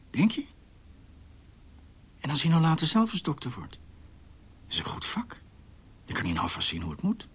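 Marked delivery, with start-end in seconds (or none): 3.7-5.4 soft aside. Acted. Whispered to wife.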